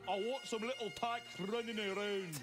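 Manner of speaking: put-on a light Scottish accent with a laugh; mock Scottish accent. Imitating Grace wanting kids, light Scottish accent. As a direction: toss off a quick Scottish lilt. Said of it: "That's pretty good Scottish accent".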